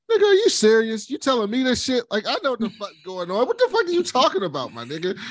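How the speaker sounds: high, incredulous mock outrage; exasperated Laenor impression. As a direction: play him baffled and fed up. Retelling Laenor's exasperated reply.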